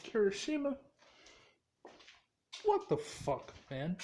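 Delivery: high-pitched acted exasperation; pissed off. Pissed character confronts his friend at the door, exasperated. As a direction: call him out high, without shouting. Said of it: "and look pissed".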